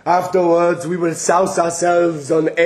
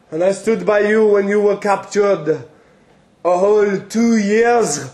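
usual speaking voice

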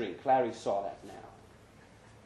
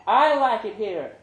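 high, louder voice, stress on I; smiling Brenda; 8 semitones higher, 8 dB louder than usual. Acted. Brenda telling Larry she's happy here, high and smiling. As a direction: say it with a smile.

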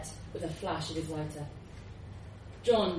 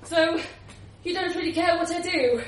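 indignant; 9 semitones higher, 5 dB louder than usual. Acted. John hurt by indifference.

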